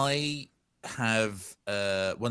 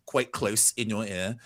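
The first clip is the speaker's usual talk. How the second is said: usual speaking voice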